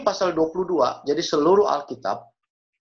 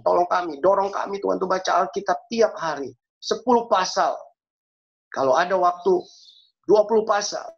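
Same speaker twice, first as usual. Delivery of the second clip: higher-pitched, insistent plea; urgent pleading prayer; 3 semitones higher than usual. Real, not acted. Preacher begs God to push them to read Scripture, urgent prayer. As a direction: press each request on God.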